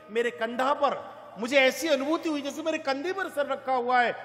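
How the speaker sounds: ordinary talk, nothing marked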